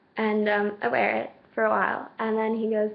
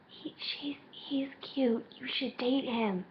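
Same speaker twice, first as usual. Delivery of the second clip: very soft, secretive whisper; confiding whisper; 11 dB quieter, slower than usual. Retold or imitated. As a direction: whisper your approval to a friend. Friend confides about the boy.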